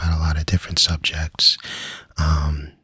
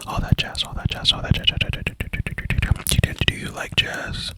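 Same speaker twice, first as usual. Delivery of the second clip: whispered trigger words, fading out; breathy repeated jazz, slowing; tingly asmr mouth sounds; 7 dB quieter than usual. Acted. Whispered trigger-word repetition.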